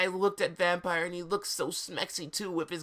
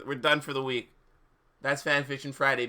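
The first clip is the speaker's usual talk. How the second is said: ordinary delivery, nothing marked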